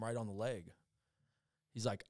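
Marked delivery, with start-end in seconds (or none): none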